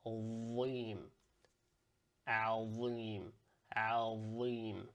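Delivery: Azim repeated with a full emphatic z; Arabic emphatic Azim. Shown as a demonstration. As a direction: say Azim full and heavy each time. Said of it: "So you're saying something like this".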